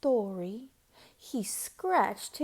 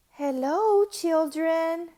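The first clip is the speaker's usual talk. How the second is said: higher, sweet and bright greeting; 6 semitones higher, 8 dB louder than usual. A narrator's reading. Kind teacher returning to her class, sweet voice. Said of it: "someone said in a sweet voice".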